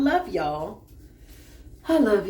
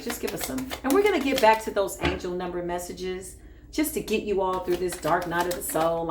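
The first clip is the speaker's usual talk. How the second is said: normal talking voice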